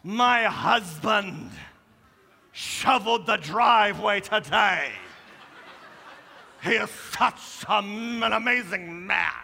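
slow, showy gushing about husband; hammed-up bragging post read aloud; posting highlights on Facebook, slow mock gushing; mock Facebook boast. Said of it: "I only put my highlights".

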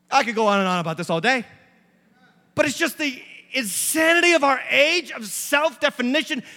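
loud, rising preacher's exhortation; full-force preaching. Acted. Pastor pleading with the congregation, loud and rising. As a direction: drive it home like a pulpit call.

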